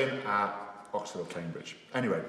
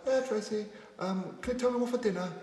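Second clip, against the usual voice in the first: much higher put-on Japanese accent; 12 semitones higher than usual. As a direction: ask about dinner in a mock Japanese accent. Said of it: "I was like".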